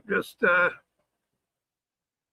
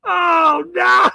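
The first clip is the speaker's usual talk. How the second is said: loud helpless laugh-cry; crying with laughter; 8 semitones higher, 7 dB louder than usual. Real, not acted. Streamer overwhelmed by laughter, crying and shouting.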